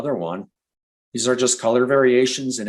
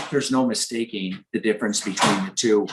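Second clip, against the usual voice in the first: usual speaking voice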